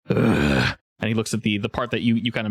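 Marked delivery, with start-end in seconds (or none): 0.1-0.8 low grunt of disgust; disgusted ogre grunt. Acted. Ogre chief watches the ice melt, low grunt. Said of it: "and he goes".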